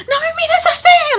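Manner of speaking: very high, loud imitation of an outburst; exaggerated high-pitched Spanish snapping; mock shrieking scolding. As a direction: screech it like someone triggered. Mimics upset woman snapping at her.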